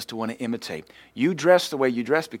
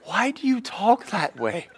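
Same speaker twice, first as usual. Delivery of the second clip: put-on slow, high little girl's question; laughing child's voice; 10 semitones higher, slower than usual. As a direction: ask like a puzzled toddler.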